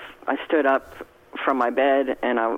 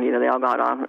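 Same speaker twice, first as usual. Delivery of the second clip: ordinary talk, nothing marked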